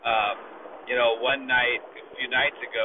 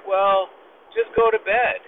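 landlord voice pitched high and louder; mock dismissive landlord; 8 semitones higher, 6 dB louder than usual. Landlord brushes off tenant's complaint, high and loud. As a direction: toss it off like a smug landlord. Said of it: "the landlord's like".

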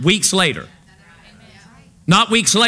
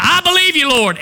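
mock believer; 7 semitones higher than usual. Mocking insincere believer.

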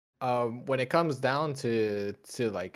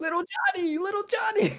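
high hammed-up wail; mock wailing parent. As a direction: wail the name like a grieving parent. Mock grieving parent cry.

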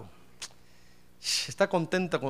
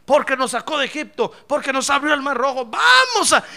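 loud, high, rising protest; crowd's insistent pledge; 7 semitones higher, 8 dB louder than usual. Acted. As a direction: shout it as a people insisting. People insist in acted argument. (insistencia a gritos del pueblo)

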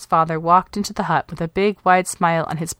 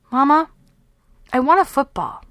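put-on high little girl's voice asking Mama; a small girl asking for something she wants; young girl, high and eager; child pleading; 6 semitones higher than usual. A narrator's reading. Child asking mother for football.

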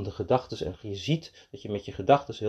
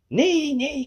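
high-pitched storybook "no, no"; eager protest. A narrator's reading. Mees correcting the rabbit, eager protest.

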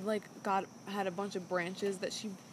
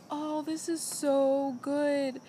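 higher, drawn-out gushing; freaking out happily; 4 semitones higher, slower than usual. Retold or imitated. Podcaster retelling the contestant's joy over her trout, gushing. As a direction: imitate someone overcome with happiness, slow and high. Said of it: "like, freaking out".

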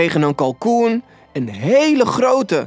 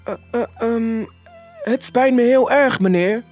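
high, stammering apology; nervous apology; 10 semitones higher than usual. A narrator's reading. Robert apologises to his boss for lateness, nervous and high. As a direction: stammer first, then apologise high-pitched.

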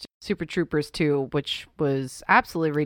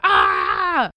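short high scream; brief imitated yell at the cat; mock scream; 5 semitones higher, 3 dB louder than usual. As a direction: let out a wordless retold scream. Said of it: "and I just screamed".

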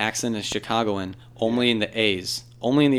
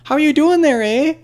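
much higher, louder Minnesota twang with a tacked-on eh; sing-song Minnesota greeting ending in eh; Minnesota accent; 10 dB louder than usual. Retold or imitated. Mimicking a Canadian who sounded Minnesotan, high and loud Minnesota lilt. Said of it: "a Minnesota vibe where they're like".

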